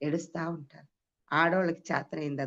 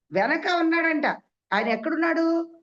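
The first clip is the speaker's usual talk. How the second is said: higher, louder climax; excited proclamation; 10 semitones higher, 5 dB louder than usual. Acted. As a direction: lift it to a peak of wonder. Preacher's climactic question. (ఆశ్చర్యంగా గట్టిగా)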